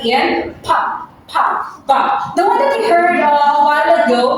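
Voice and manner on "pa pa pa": "pa" with an airy burst from the lips; puff of air. Shown as a demonstration. Teacher shows class a plosive, "pa" aspirated. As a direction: pop the P in "pa" with a puff of breath. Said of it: "Parang may hangin".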